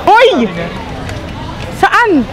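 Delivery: shouting after a friend who walked ahead; real shout. Real, not acted. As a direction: yell across the shop at a friend. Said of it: "ba't ako sumisigaw dito".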